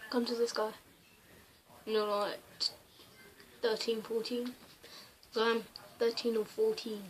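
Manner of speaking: whispering. Real, not acted. Hushed talk to camera.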